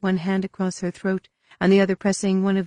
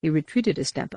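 ordinary delivery

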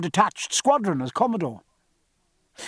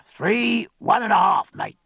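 wheezy, raised quartermaster's voice; wheezing; 5 semitones higher, 3 dB louder than usual. A narrator's reading. Quartermaster gives the log reading to his mate, wheezing. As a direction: wheeze out the count like an old tobacco-chewer. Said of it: "and the quartermaster wheezed".

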